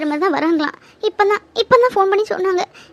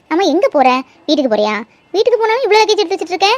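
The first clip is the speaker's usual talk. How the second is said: usual speaking voice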